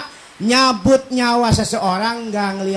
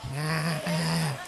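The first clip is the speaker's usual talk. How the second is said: ordinary talk, nothing marked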